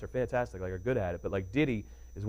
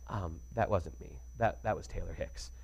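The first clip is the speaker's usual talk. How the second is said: soft slow correction; 6 semitones lower, 10 dB quieter, slower than usual. Retold or imitated. [name] correcting him, quoted.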